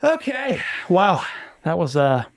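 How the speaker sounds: breathless after a long telling; out of breath, puffing a little. Real, not acted. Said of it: "I'm out of breath".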